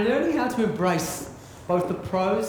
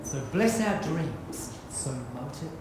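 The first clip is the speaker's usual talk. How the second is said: solemn blessing poem; 3 semitones lower than usual. Acted. Captain leads the crowd in a blessing, hushed recital. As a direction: bless each one gently like a spell. One last poem, eyes closed.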